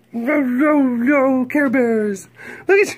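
high, loud squeal. Real, not acted. Owner squealing over the Care Bear blanket, high squeal. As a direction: squeal it with delight.

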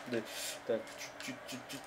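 ordinary talk

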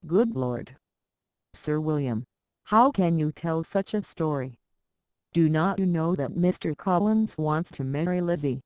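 ordinary delivery

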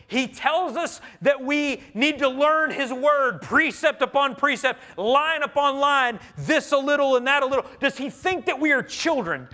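high, loud, sneering parody of the lesson; mock the preacher's words like a scornful crowd. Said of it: "the people are mocking the Lord".

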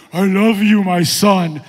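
very loud, drawn-out voice of God; mock-thunderous heavenly proclamation; booming voice from heaven. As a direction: boom it out like God from the sky. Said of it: "this booming voice from heaven".

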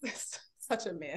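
soft laughing tease; teasing laugh. Real, not acted. Teasing her co-guest about being such a man, laughing softly. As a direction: laugh at him, amused, under her breath.

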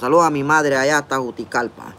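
ordinary delivery

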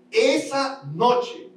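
slow, drawn-out answer; slow emphasis. Shown as a demonstration. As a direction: lean on the words slowly. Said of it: "¿Cuándo?".

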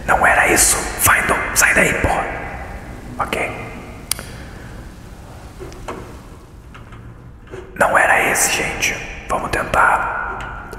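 whispered puzzle-solving, no voice; ASMR whisper. Pretend. Streamer guessing the animal puzzle aloud, ASMR whisper. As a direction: think aloud entirely in whispers. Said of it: "A S M R na caverna".

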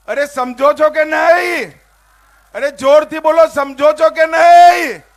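high, loud rally shout; calling out to crowd. Real, not acted. Rally speaker to crowd, demanding they answer, shouting.

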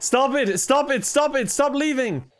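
high, repeated frantic protest; urgent pleading. Real, not acted. Player to viewers leaving the broadcast, urgent pleading. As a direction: beg them to stop, pitched right up.

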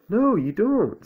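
high, loud contradiction; insistent Owl. A narrator's reading.

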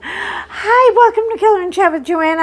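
high, fast cheery hello; bright show intro. Real, not acted. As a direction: greet viewers like a host. Cheery show opening greeting.

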